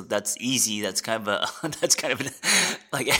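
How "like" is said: ordinary talk, nothing marked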